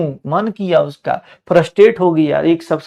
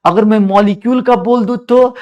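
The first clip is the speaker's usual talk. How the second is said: ordinary talk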